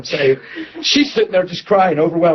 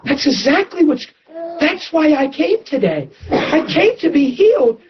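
high, tearful and eager, voice catching; excited tearful reply; 7 semitones higher than usual. Retold or imitated. Woman in the front row answering the preacher, high and tearful. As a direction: retell her near-tearful eagerness to be healed. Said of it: "She said".

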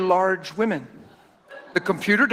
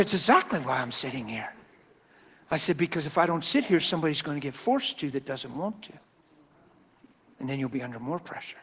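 calm reassurance; 4 semitones lower, 8 dB quieter than usual. Retold or imitated. Calm reply to nervous mother.

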